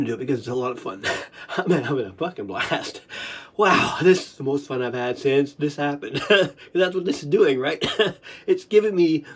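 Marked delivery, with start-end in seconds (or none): none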